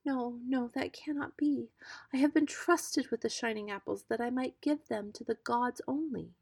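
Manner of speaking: clinging to her duty to the gods; timid but firm maiden; frightened refusal. Acted. Iduna refuses again.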